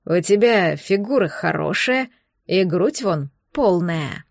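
low, slow gloating monster voice. Acted. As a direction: savour each word, low and leering. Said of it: "злорадно приговаривает".

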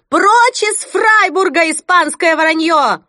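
high, loud furious yell; angry shout. Acted. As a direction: drive the Spaniards out with fury. Women shaking fists, shouting. (яростный крик)

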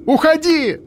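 desperate high scream. A narrator's reading. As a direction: scream at him to go. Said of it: "закричала она".

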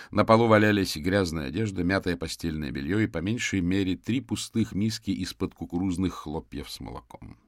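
plain reading voice

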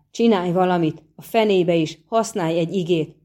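plain reading voice

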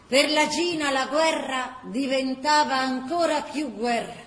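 ordinary talk

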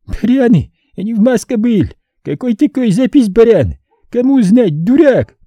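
высоко, громко, с ломаным русским; огрызается, бросая оскорбления; злой юный мститель. Acted. Umar voiced, broken Russian. (раненый мальчишка кричит на следователей, высоко и зло)

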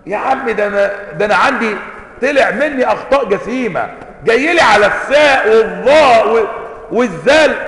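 loud, high-pitched indignant complaint; exasperated protest. Acted. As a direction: protest loudly at being nitpicked. Exasperated reply to a nitpicking critic.

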